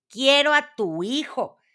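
high, loud dwarf demand. A narrator's reading. Dwarf demanding the queen's child, high loud demand. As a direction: insist on it, high and forceful. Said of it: "exigió el desaliñado enano".

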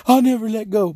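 high-pitched mock movie heroine; Rose. Rose to Leonardo in the water, mocking imitation. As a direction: mimic a pleading heroine up high. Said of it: "Rose is a sorry".

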